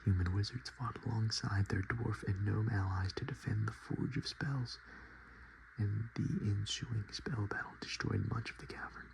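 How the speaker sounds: usual speaking voice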